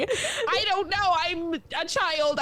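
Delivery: put-on high, slow, drawn-out kid protest; protesting child. Lost child answering parents on the dock, high drawn-out protest. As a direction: stretch it out like a helpless kid.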